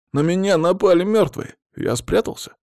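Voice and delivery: high-pitched, sobbing villain's plea; pitiful high whine, acted; whimpering. A narrator's reading. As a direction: whimper it like a frightened victim. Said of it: "всхлипывал тот злодей".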